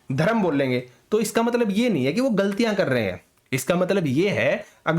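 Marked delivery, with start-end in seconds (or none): none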